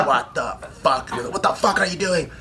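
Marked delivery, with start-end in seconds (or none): 0.1-1.1 a hint of drill instructor croak, slow; frog voice. Drill instructor walking by the shower, lightly imitated frog voice. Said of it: "that frog voice". 1.3-2.3 higher-pitched mock drill instructor; frog voice. Drill instructor confronts recruit, imitated frog voice. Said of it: "that frog voice ... they're screaming all day".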